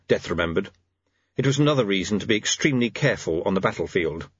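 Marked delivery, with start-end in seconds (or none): none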